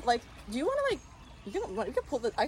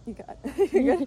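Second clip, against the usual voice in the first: laughing; 5 semitones higher, 7 dB louder than usual. Real, not acted. Laughing at friend's reaction.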